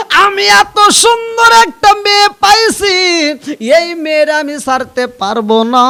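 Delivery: very loud, high chanted declaration; full-force preaching cry; impassioned outcry. Acted. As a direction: belt it out high, then drop in pitch. Son's words repeated at full force.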